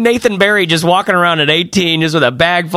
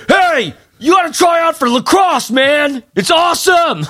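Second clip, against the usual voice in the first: jock shout; 12 semitones higher, slower than usual. Retold or imitated. A jock recruiting students at a college booth, shouting. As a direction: bellow it like a pumped-up recruiter. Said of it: "the jock guy's like".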